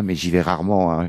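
normal talking voice

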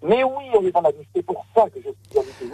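echoing from the shower; boomy, distant like a tiled bathroom. Real, not acted. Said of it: "on vous entend plus du tout". (voix qui résonne dans la salle de bain)

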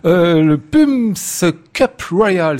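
slow, careful English-accented "Pumps Cup Royal"; a French host's hesitant try at an English accent; English accent attempt. Shown as a demonstration. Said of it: "si mon accent anglais s'est amélioré".

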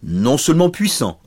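a hint of a confident dragon; dragon replying. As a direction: encourage the little knight. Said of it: "répondit le dragon".

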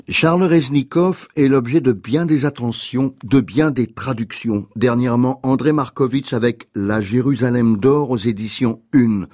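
ordinary delivery

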